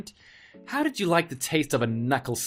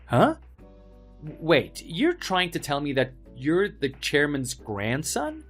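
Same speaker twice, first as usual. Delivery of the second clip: stunned disbelief; 4 semitones higher than usual. Acted. Bully stunned by the reveal.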